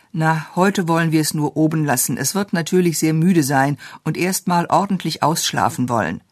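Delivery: ordinary delivery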